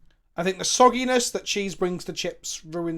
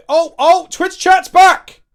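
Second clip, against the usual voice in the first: much higher, much louder cheer; excited shout; 9 semitones higher, 15 dB louder, much slower than usual. Real, not acted. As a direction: burst out in delight. Chat reconnects, both cheer.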